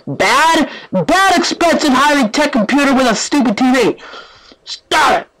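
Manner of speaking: high-pitched, exasperated telling-off; scolding. Real, not acted. Gamer telling off the glitching computer, scolding.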